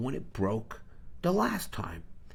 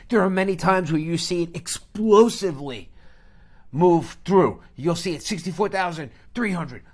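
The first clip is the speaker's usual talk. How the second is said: high, loud, mounting excitement; excited play-by-play; 6 semitones higher, 7 dB louder than usual. Shown as a demonstration. Trader showing viewers a price exploding through levels, excited play-by-play. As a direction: call the numbers like a runaway climb.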